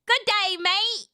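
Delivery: high-pitched mock Australian; Australian accent attempt. Shown as a demonstration. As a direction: try a broad Australian greeting. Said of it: "Sounds exactly like an Australian".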